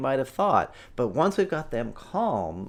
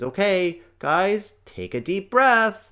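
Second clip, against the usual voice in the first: put-on higher, louder, slow little-girl voice; imitating little daughter; 8 semitones higher, 7 dB louder, slower than usual. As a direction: play the four-year-old bossing her parents to calm down. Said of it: "the first thing Evie says is".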